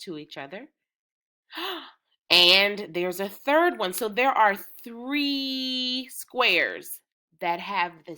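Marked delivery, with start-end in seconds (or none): 1.5-3.9 a gasp, then louder with delight; sudden discovery, louder; surprised gasp. Real, not acted. Gasps at third match. 3.9-7.0 dragged out slowly, pitch raised; slow emphasis. Shown as a demonstration. Stretching out the discovery.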